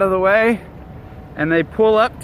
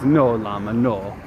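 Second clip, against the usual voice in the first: Teo impression; 6 semitones lower than usual. Quoting what a friend always says, low mock voice. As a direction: lower imitation of a friend. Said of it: "all I ever hear Teo say".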